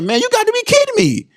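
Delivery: can't believe the gloating; amused disbelief. Retold or imitated. Dad to son who thinks he won fairly, amused disbelief.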